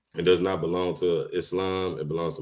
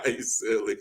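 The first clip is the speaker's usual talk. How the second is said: laughing; 6 semitones higher, 7 dB louder than usual. Real, not acted. Reacting to viewer comment.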